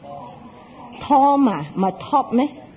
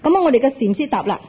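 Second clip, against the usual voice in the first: normal talking voice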